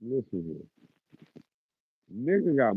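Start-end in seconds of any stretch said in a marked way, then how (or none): 0.0-0.6 quiet warning. Real, not acted. Streamer repeating the warning to a poster, much softer. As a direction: drop it down, menacing and quiet. Scolding chat, repeated command.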